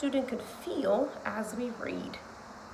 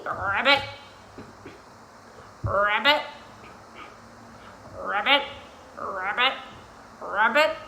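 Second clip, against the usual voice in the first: short croaked ribbit, rising at the end; frog ribbit; 4 semitones lower than usual. Shown as a demonstration. Teacher makes animal sounds for kiddos, frog croaks. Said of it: "I'm going to make animal sounds".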